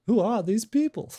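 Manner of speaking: Jerry Seinfeld impersonation. Acted. Podcast host playing along with a listener's cue, Jerry Seinfeld impersonation. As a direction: pitch it way up like an incredulous comedian's catchphrase. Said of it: "Cue Jerry Seinfeld".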